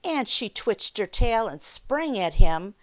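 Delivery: unhurried read-aloud for little listeners; children's story time narration. A narrator's reading. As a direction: tell it like a picture-book storyteller.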